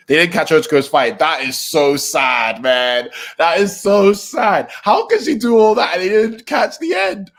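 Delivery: loud, drawn-out lament, climbing high; upset outburst. Real, not acted. Upset outburst over missing fight footage.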